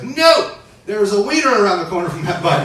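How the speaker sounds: high, loud alarmed "No!"; heart's panicked shout. Acted. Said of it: "My heart was like".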